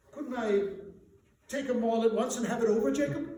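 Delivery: fast, high, rushed bargaining; hurried plea. A narrator's reading. Scrooge bargaining with the ghost, fast and nervous. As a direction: rush to get it over with.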